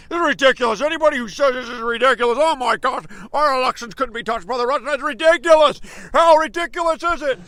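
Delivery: a lightly voiced, fast, dismissive Obama; mocking Obama. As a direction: brush it off as ridiculous, faintly mocking. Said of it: "He's like".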